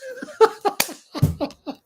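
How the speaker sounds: high burst of laughter; real helpless giggling; cracking up. Real, not acted. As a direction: lose it laughing. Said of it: "I'm still laughing at that".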